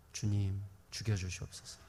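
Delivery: quiet mock plea to the Lord; hushed desperate prayer. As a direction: pray it under the breath, begging.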